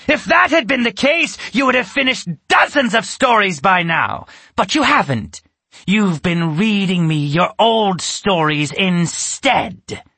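high, loud accusing witch; a witch berating the boy; angry witch. A narrator's reading. Witch accusing Alex angrily.

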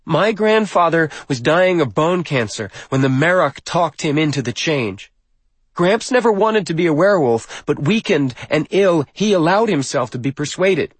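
put-on high, loud accusing young woman; bitter speech. A narrator's reading. Granddaughter accuses from the pulpit, bitter and high. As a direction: project a rehearsed grievance, pitched high. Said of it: "her bitterness apparently unchecked".

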